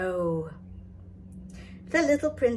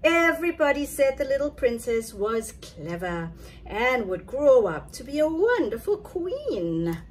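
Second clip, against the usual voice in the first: plain reading voice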